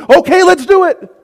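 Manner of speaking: high, loud, instant U-turn; sudden eagerness. Acted. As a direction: burst out gung-ho and willing. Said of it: "a radical reversal".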